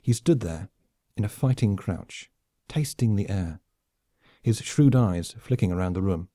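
plain reading voice

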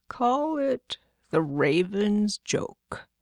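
very slow, drawn-out god's voice; slow, deliberate dictation; Raven. Acted. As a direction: stretch each word out, like a god pronouncing a title.

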